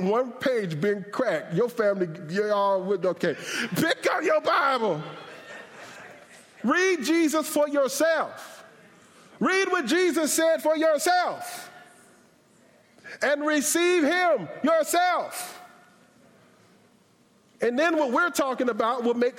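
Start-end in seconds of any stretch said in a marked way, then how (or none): 3.8-5.0 high hollered command; comic preacher's holler; emphatic shout. Real, not acted. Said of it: "say it in such a way you won't forget". 6.6-15.6 high, drawn-out exhortation; ringing, stretched-out preacher's call; forceful preaching. Real, not acted.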